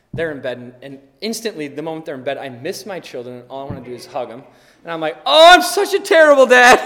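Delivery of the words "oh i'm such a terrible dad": loud, high mock lament; hammed-up guilty wail. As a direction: wail with exaggerated parental guilt. Acting out his parental guilt.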